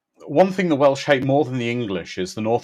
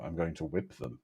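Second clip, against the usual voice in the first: much lower, much softer deadpan threat; deadpan low mutter; 7 semitones lower, 15 dB quieter than usual. Retold or imitated. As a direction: say it flat and low, mock-serious. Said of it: "he's packing his whip".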